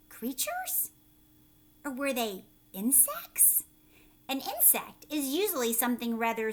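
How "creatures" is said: high squeaky questioning. Acted. Teacher asking the children, high squeaky question.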